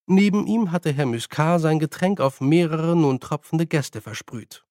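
ordinary delivery

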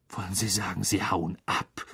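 understated incredulity, pitched low; incredulous. A narrator's reading. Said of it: "sagte Harry ungläubig".

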